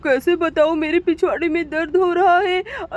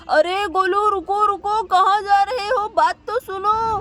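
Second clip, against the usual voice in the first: put-on higher voice calling after the kid; calling after son; 5 semitones higher than usual. Father calling after his son who is running off. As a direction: call after a boy running off.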